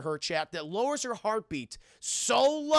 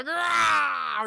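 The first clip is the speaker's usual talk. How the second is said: mock roar; 9 semitones higher, 6 dB louder than usual. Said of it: "he does the".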